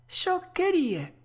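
high, drawn-out exclamation; puppet's cry. Acted. Puppet dismisses the tuna's philosophy, high drawn-out cry. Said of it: "gridò Pinocchio".

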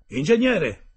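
high, loud cry to the engineer; loud exclamation. Acted. The captain shouts for the engineer over the engines. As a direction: bark the title out sharply. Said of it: "esclamò quindi".

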